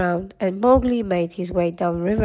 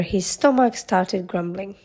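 usual speaking voice

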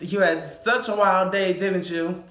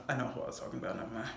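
dropped to a quiet mutter; muttered aside; 6 semitones lower, 11 dB quieter, much faster than usual. Real, not acted. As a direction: mumble it under your breath. Muttered confession.

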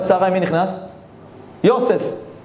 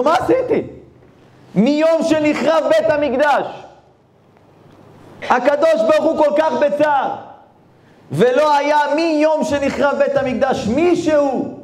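lamenting sage; 10 semitones higher, slower than usual. Acted. Ari reveals God's sorrow.

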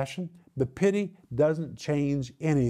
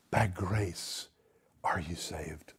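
whispered, much softer close of the verse; a whispered reveal of grace; hushed scripture; 10 semitones lower, 9 dB quieter, slower than usual. Acted. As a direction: drop to a breathy whisper on the promise. Dramatic hushed scripture reading.

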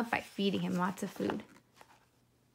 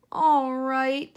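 sighing; 4 semitones higher, 7 dB louder than usual. A narrator's reading. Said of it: "sighed the piglet".

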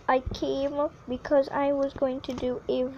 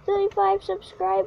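mock last words; 7 semitones higher, faster than usual. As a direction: cry out a hammed-up goodbye. Mock last words before risky jump.

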